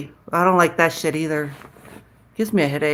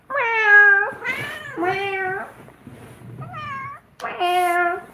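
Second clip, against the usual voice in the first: high meows trailing softer; calling the cat; 3 dB quieter, much slower than usual. Shown as a demonstration. Owner still calling her cat with fading high meows. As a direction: keep meowing up high, then let it fade. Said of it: "That's how I call her".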